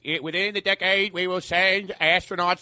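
JFK. Retold or imitated. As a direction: do a stretched-out presidential pledge. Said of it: "you know how J F K was like".

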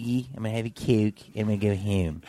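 thick, low-pitched Philly accent. Shown as a demonstration. Showing the host the hometown sound, thick Philly accent. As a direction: lay on the Philly vowels. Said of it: "I know that accent you're doing".

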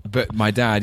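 ordinary delivery

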